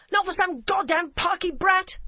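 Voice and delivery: high, loud contemptuous shout; angry shout. A narrator's reading. DCI refuses to stop for the girl, high loud angry shout. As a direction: spit out the contempt. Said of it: "Emily shouted".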